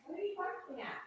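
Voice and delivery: high, soft pet voice, repeated; talking to a pet. Real, not acted. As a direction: ask the dog again, high and hushed. Repeated to dog.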